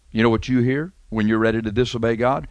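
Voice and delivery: ordinary delivery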